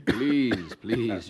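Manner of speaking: laughing protest; laughing please. Acted. Franklin laughing off the compliment, amused protest. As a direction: brush off the praise with a laugh.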